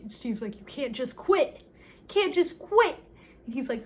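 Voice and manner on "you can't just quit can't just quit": loud retold outburst, rising in pitch; mock-indignant, punched hard; indignant protest. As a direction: imitate an upset swimmer protesting, building louder. Acted quarrel with the coach.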